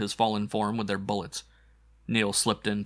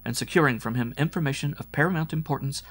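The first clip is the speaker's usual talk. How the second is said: higher-pitched, ceremonial proclamation; formal citation reading; 5 semitones higher than usual. Acted. Nurse reading a medal citation to wounded scout, formal. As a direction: read it out as an official award.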